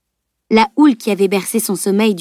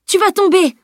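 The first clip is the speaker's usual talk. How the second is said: alarmed yell; 11 semitones higher than usual. A narrator's reading. Salim shouts warning to Camille, frightened. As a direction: warn her sharply before she falls. Salim afraid she falls.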